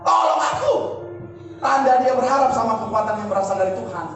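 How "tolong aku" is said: high, raised cry for help; shouted plea. Acted. Sinking Peter calling on God for rescue, desperate shout. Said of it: "dia teriak".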